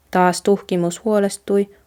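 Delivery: usual speaking voice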